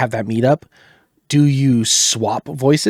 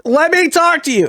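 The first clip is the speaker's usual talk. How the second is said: high, strained projected call; voice picking up in pitch; 14 semitones higher, 3 dB louder than usual. Shown as a demonstration. Demonstrating projection to people who say what, high-pitched. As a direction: pitch it way up to be heard. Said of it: "my voice picks up in pitch".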